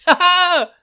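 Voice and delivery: high loud ha ha; mock laugh. Host cackling after the jingle, high and loud. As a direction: bark a gleeful stagey laugh.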